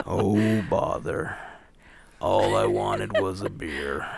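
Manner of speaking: low, slow, droopy cartoon bear voice, dropped well below her own; sighing like a gloomy honey-loving bear; Pooh-style impression. Co-host imagines a sulky cartoon bear wanting beer, low and slow. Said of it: "I'm sure he never said that".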